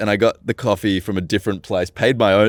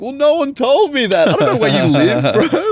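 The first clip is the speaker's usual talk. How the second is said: high, loud and exasperated, laughing through it; new worker retelling his flustered reply to the boss, high and laughing; indignant retelling; 3 dB louder than usual. Retold or imitated. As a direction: protest your innocence, cracking up. Own retort retold, laughing.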